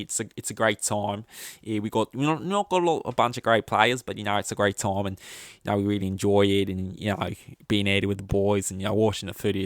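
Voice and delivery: laid-back mock Australian accent. Shown as a demonstration. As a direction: put on a matey Australian accent, slightly overdone. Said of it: "one more time".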